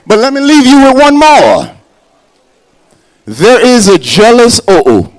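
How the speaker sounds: ordinary delivery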